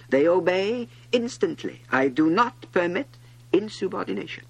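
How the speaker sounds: high, loud, stern proclamation; imperious king. A narrator's reading. King on the obedient stars, loudly imperious. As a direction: proclaim absolute authority. King boasts of absolute authority.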